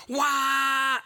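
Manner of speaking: loud, high wailing "Wah" in imitation of the fan's noise; imitated fan roar. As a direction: belt out a mock drone like a box fan. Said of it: "box fan right here going".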